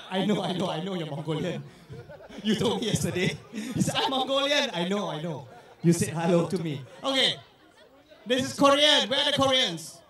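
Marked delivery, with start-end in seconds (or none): 0.1-6.8 quiet, laughing low asides; host laughing at a Mongolian guest; laughing teasing. Real, not acted. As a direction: chuckle through the words while teasing.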